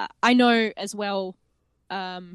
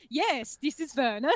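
put-on deadpan German-accented phone manner; 4 semitones higher than usual. Werner answering the caller, German accent. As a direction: confirm it formally in a German accent. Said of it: "that is the Mueller family accent".

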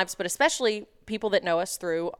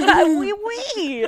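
mock French; 11 semitones higher, 4 dB louder, much slower than usual.

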